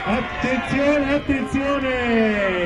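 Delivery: slow, high, stretched attenzione; drawn-out commentator call. Acted. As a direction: announce it like a stadium commentator. Goal announcement build-up.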